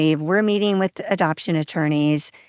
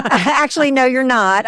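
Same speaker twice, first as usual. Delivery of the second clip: louder, higher mock correction; retold firm contradiction; 7 semitones higher, 5 dB louder than usual. Coworker overrules the adoption plan, retold in a lightly insistent voice. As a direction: act out cutting in to overrule someone.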